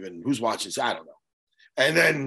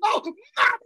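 mock panicked stammer, going much higher; flustered stammer; 10 semitones higher, slower than usual. Host imitating himself stumped on air by political questions, mock panicked. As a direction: imitate getting caught off guard by a political question. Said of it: "I'll be like".

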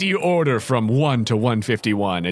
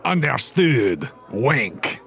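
French chef voice; much slower than usual. Acted. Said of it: "He twirls his mustache ... he says".

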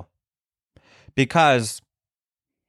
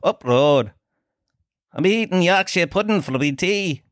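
badly faked Leeds accent, rising higher; 5 semitones higher than usual. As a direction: ham up a caricature Leeds accent. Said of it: "Is that even a Leeds accent?".

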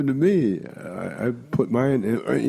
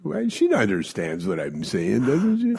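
put-on puzzled old lady; 4 semitones higher than usual. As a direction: ask it high and baffled.

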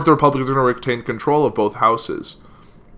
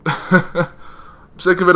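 higher, slow, laughing through "I'm sick of it"; drawn-out laughing sigh of "sick of it"; 6 semitones higher, much slower than usual. Real, not acted. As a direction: laugh out the words in exasperation. Repeats complaint with a laugh.

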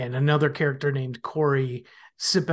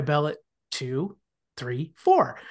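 slow, spaced-out counting of the rings; slowed-down bell count. Retold or imitated. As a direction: count with long gaps between beats. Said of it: "could you slow it down?".

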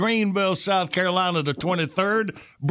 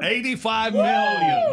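amazed; slower than usual. Real, not acted. Host echoes huge view count.

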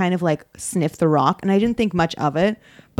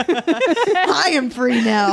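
slow, higher-pitched mock proclamation; escaping dad; 5 semitones higher, much slower than usual. Dad freed by an open door, slow mock proclamation. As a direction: announce freedom slowly, hammed-up.

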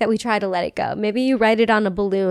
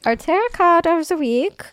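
playful higher German accent on "Our tarot card of the week"; 7 semitones higher than usual. Shown as a demonstration. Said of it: "can you do German again?".

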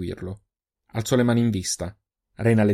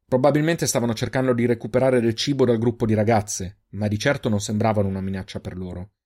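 usual speaking voice

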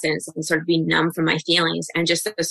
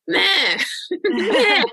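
high, feeble little bleat; wimpy; 12 semitones higher than usual. Retold or imitated. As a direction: imitate a timid, half-hearted attempt at screaming. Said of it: "I was so wimpy".

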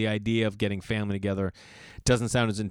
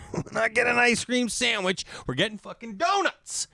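mock exasperated dad; 12 semitones higher than usual. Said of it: "And I'm like".

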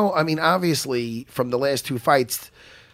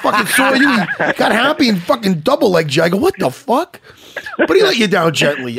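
laughing retelling; 5 semitones higher than usual. Real, not acted.

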